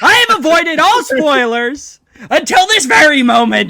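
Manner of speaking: high, loud protest; loud, high-pitched exasperation; upset outcry. Real, not acted. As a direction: wail it as a wronged fan. Upset at being spoiled.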